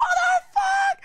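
high panicked scream; terrified yell mid-jump; retold scream. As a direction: shriek it as you leap. Jumping off a cliff screaming.

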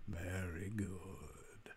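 slow eerie whisper. Acted. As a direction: breathe it slowly, approving. GM as deity, whispering.

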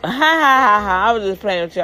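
laughing it off, quick and high; mocking laugh. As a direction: brush it off with a fake teasing laugh. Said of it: "Then he gonna laugh".

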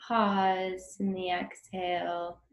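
ordinary talk, nothing marked

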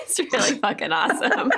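laughing through the words. Real, not acted. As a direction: repeat it while laughing. Laughing echo of guest's words.

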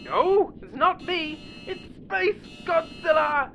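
high, drawn-out fake scream of alarm; hammed-up falsetto wail; mock terror. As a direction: play at monster-movie panic. Pretends a monster rocks ship.

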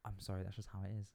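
soft, fast, mumbled waiter apology; quiet waiter. Pretend. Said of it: "You're the waiter".

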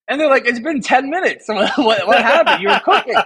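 cracking up while quoting friends; laughing. Retold or imitated. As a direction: laugh as the teammates' reaction. Retelling teammates' amused disbelief.

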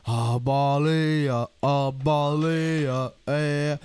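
very slow, drawn-out mock lesson voice; "a baleia" drawn out like a kids' show host; slow teacher voice. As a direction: stretch every word like a children's teacher.

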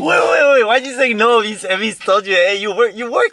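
very high, loud mock questioning; mock incredulous. Friend mocking the confusion, high and loud. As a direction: pile on the wait wait.

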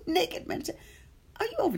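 high, fast laughing exclamation. Real, not acted. Host reacting to a naked meditation comment, laughing high.

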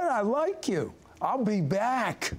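beam it out with joy; delighted. Retold or imitated. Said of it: "and then say".

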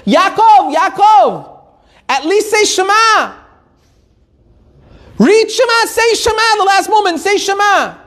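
urgent pleading call. Retold or imitated. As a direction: beg him to say Shema before it's too late. Friend urging dying man.

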